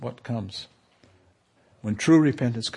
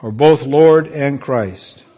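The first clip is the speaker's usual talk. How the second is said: preaching; 2 semitones higher, 6 dB louder than usual. Acted. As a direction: proclaim each step of the gospel. Preaching the gospel, slow and emphatic.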